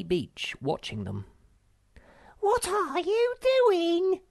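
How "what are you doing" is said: very high, slow, loud cry. A narrator's reading. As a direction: call out in shock from the beach. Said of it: "she cried".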